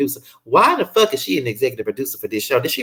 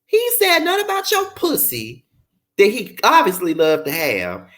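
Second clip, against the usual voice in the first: ordinary talk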